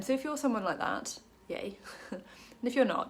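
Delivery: ordinary talk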